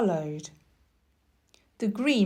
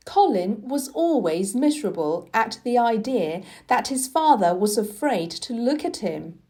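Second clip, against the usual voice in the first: usual speaking voice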